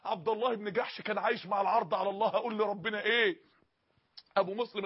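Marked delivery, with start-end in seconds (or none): none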